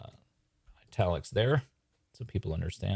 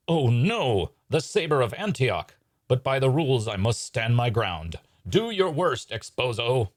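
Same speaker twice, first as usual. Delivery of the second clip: grand, raised stage declamation; melodramatic magician; 5 semitones higher, 5 dB louder, slower than usual. Acted. Hydini standing his ground against Exposo's saber, melodramatic. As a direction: face doom with hammed-up bravery.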